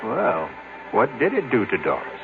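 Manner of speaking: normal talking voice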